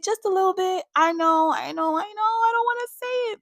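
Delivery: high, squeaky reluctant confession; reluctant admission. Real, not acted. Reviewer admitting a criticism of a favorite character, high squeaky reluctance. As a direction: pitch it way up, pleading not to be blamed.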